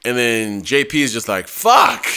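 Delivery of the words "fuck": a short higher, louder yelled fuck; mock yell. Drunk friend outside the door yelling in a retold mock yell. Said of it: "Just yells".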